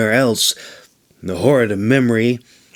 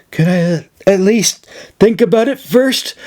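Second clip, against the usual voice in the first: breathless, voice pitched far up and louder; panting; 9 semitones higher, 4 dB louder than usual. A narrator's reading. Brumir pants to Irene, breathless and pitched high. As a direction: gasp it out, flustered and out of breath. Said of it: "Brumir panted".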